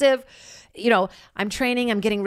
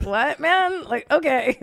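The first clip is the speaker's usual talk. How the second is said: higher, louder mock dismissive scoff; blatant teasing; 8 semitones higher, 4 dB louder, slower than usual. Island guy brushing off the ex, loud scoffing tease. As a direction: brush him off with open scorn. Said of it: "Like, just really blatant".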